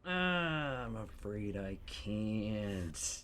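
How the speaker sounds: ominous slow line. Acted. Acted scene, dramatic reading.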